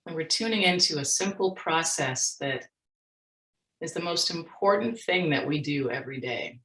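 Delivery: soft, unhurried meditation voice with long pauses; guided meditation. Real, not acted. Speaker leading workshop audience in mindfulness practice, guided meditation. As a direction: guide listeners gently into their breath, leaving space.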